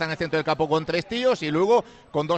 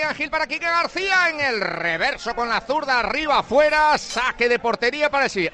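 higher, sustained football commentary; live play-by-play; 8 semitones higher than usual. Real, not acted. Radio commentator follows a shot going wide, live play-by-play. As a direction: call the attack as it unfolds.

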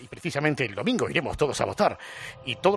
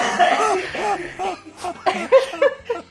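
hearty cackling laugh; loud high laugh; 4 dB louder than usual. Real, not acted. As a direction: burst out laughing loudly. Big laugh.

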